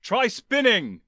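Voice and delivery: loud, slow, high yell. Acted. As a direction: bellow the order across space. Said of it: "leaned through the hatch now and yelled".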